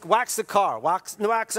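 normal talking voice

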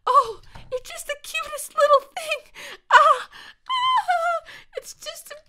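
very high squealing coo. Real, not acted. Gamer coos at a cute new puppy, squealing baby talk.

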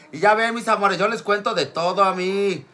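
normal talking voice

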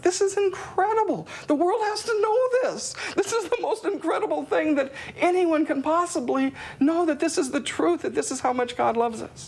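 joyful exclamation. Retold or imitated. Discovering God's love, a very high joyful exclamation. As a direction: burst out in overwhelming joy. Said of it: "It was like".

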